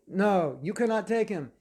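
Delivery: loud, high-pitched forbidding; acted emphatic objection; firm refusal. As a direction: refuse them outright, forcefully. Acted court-style quarrel.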